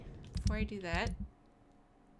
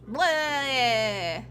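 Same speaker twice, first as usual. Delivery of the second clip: vampire blah; 8 dB louder than usual. Pretend. Mock vampire attack cry.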